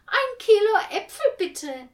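high, loud sugary sing-song; fluting sweetly. A narrator's reading. The two women order apples, high sweet fluting voice. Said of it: "flöteten die beiden".

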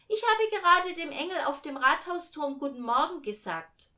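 put-on high, loud child's explanation; insistent little girl. A narrator's reading. Millie explains to her mother.

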